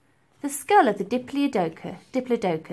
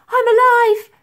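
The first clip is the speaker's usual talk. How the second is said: much higher, loud joyful cry; excited exclaim; 13 dB louder than usual. A narrator's reading. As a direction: shout it out in relief. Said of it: "she exclaimed".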